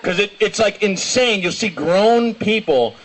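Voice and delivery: usual speaking voice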